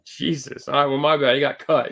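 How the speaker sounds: usual speaking voice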